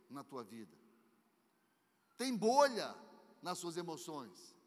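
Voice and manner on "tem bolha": loud, emphatic preaching; preaching shout. Real, not acted. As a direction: hammer the point home.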